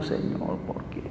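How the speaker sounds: whispered, broken plea to God; breathless teary whisper; crying prayer. Retold or imitated. Preacher crying alone in his office, whispering. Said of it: "yo en la oficina llorando".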